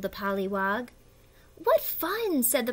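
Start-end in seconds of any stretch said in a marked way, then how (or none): 1.6-2.4 high, delighted little exclamation. Acted. Pollywog hears the caterpillar's plan, delighted. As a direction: burst out with glee.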